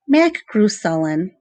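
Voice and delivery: usual speaking voice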